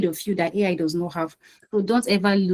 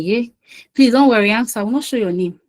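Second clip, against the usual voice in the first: normal talking voice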